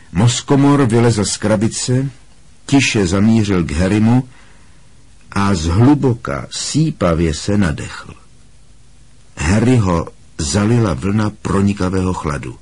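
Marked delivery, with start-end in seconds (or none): none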